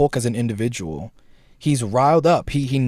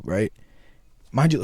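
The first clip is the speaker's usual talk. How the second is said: ordinary talk, nothing marked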